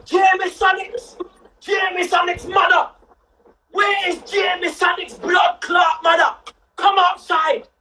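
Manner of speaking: slow, high, drawn-out yell across the estate; shouting at top of lungs. Retold or imitated. Nan calling out the boy's mother, slow high shout. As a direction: bellow the name up at the flats. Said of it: "top of her lungs".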